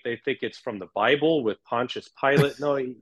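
normal talking voice

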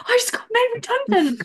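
very high, bright sing-song delight; gleeful announcement. Retold or imitated. Calling her dad, delighted about redundancy. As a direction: squeal the bad news like good news.

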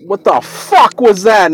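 mild mock outburst; retold blurted cuss. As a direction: let the swear slip out loud in shock. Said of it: "And I said that out loud".

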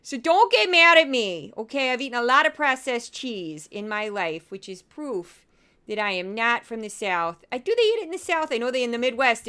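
put-on dorky Minnesota accent; Midwestern accent. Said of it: "I'm gonna do the voice".

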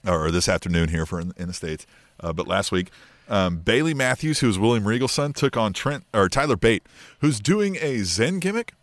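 normal talking voice

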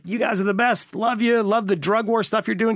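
lightly hammed-up fawning Trump; mock Trump gush. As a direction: fawn over him with cheerful glee. Mocking Trump to Duterte.

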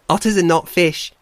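high, loud otter voice; amused otter. Acted. Shoreback corrects the bear about otters, loud amusement. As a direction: declare it loudly as an amused otter. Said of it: "clicking amusement".

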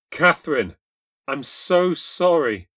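pleading through welling tears; tearful. A narrator's reading. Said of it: "I said with my eyes filling with tears".

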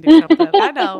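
loud, high eager I know; eagerly proving your faith. Retold or imitated. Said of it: "I'm gonna be like".

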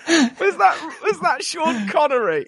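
high, loud, through laughter; laughing disbelief. Real, not acted. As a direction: tease incredulously while cracking up. Teasing the bad accent through laughter.

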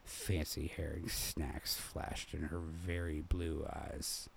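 ordinary delivery

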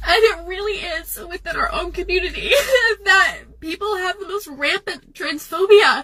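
crying laugh breaking through the words; half laughing, half sobbing. Real, not acted. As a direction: laugh through tears, voice cracking. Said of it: "people fake cry into TikTok".

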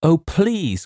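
put-on high-pitched girlish plea; crying out. A narrator's reading. Girl begs James over a frightening dog, crying out. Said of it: "cried the girl".

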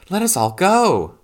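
put-on high, loud call of childlike exultation; excited cry. A narrator's reading. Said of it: "she cried".

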